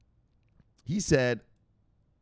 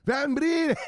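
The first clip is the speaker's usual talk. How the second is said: high, loud shouted catchphrase; yelled; 4 dB louder than usual. Retold or imitated. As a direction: yell it out of the phone. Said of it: "He yelled".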